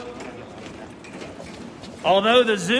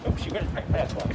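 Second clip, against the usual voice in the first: ordinary talk, nothing marked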